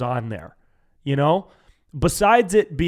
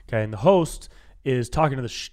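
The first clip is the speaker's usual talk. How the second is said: ordinary delivery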